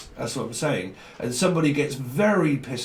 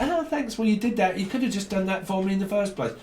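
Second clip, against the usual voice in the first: much higher, sarcastic thank-you, then faster; mock sarcastic thanks; 6 semitones higher than usual. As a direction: drip with fake gratitude. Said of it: "and you're like".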